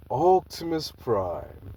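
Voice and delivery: slow, heavy giant robot drawl; slow titan voice. Acted. Trypticon the giant robot greeting Optimus, slow and heavy. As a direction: drag the name out like a towering titan.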